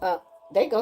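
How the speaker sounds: normal talking voice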